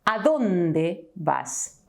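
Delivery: "¿A dónde vas?" said very slowly, each word clear; slow model sentence. Shown as a demonstration. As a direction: spell it out slowly for learners. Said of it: "But if I ask you".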